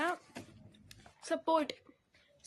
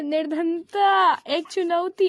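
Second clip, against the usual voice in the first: loud, slow title reading; reading title aloud; 11 dB louder, slower than usual. Real, not acted. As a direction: announce the chapter name slowly. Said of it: "reading the chapter title".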